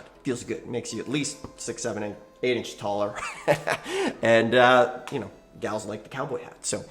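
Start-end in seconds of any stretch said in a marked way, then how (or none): 3.2-4.2 quick high chuckle at his own joke; short real laugh. Real, not acted. Host laughing at his cowboy hat joke, short high chuckle. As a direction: laugh it off, amused.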